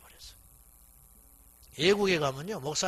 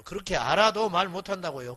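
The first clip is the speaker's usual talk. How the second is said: ordinary talk, nothing marked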